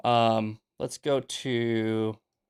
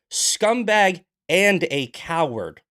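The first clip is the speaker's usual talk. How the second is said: loud, higher, slow spat insult; 5 semitones higher, 6 dB louder, slower than usual. Real, not acted. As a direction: drag out each word in contempt. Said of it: "I'm heated today".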